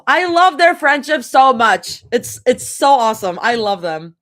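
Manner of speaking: high, loud gushing; excited. Real, not acted. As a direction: fangirl over the friendship. Reader gushing about friendship.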